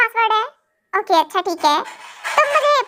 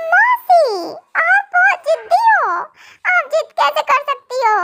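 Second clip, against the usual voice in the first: put-on whiny little girl; 7 semitones higher than usual. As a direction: whine like a stubborn kid. Niece complains to aunt.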